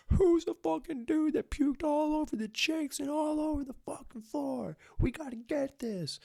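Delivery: a hammed-up meathead hunting for the puker; angry frat guy. Frat guy storming in looking for the puker, hammed-up aggressive bro voice.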